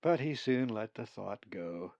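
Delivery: usual speaking voice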